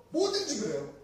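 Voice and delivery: ordinary talk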